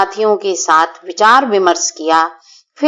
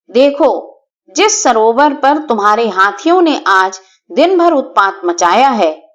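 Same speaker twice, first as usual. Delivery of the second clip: fearless; 3 semitones higher than usual. Acted. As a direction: fearlessly threaten the elephant chief. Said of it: "Champak ने निडर होकर कहा".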